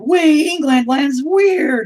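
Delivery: high, loud, drawn-out silly hollering; mock tourist whoop. Imagining self visiting England, high silly holler. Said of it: "I bet I'd be like".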